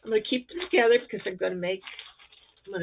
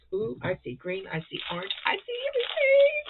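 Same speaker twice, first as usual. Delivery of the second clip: delighted gasp; 12 semitones higher than usual. Real, not acted. Opening a gift package, delighted.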